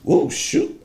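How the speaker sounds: sudden whispered oh shoot; a quick unvoiced slip when the phone drops; startled aside. Real, not acted. Phone falls mid-story, startled whisper.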